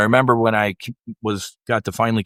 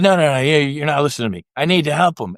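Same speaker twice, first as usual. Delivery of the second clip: insistent protest; 4 semitones higher, faster than usual. Retold or imitated. Retelling arguing with God, raised insistent protest. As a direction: refuse to be calmed down.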